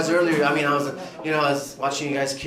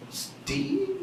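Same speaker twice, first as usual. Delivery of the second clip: mock surprise; 10 dB quieter than usual. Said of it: "I'm like".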